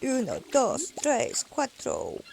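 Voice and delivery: playful Spanish count. Real, not acted.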